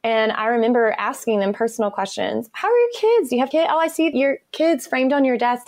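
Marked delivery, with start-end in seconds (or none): 2.5-5.7 higher-pitched, chatty curiosity; eager friendly interest. Retold or imitated. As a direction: re-enact keen small talk with a colleague. Acting out friendly questions to colleagues.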